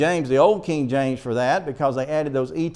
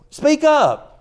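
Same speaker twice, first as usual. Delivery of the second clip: higher, louder impatient dad; father's loud speak up; 12 semitones higher, 7 dB louder than usual. Retold or imitated. Father telling mumbling child to speak up, loud. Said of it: "they'll say".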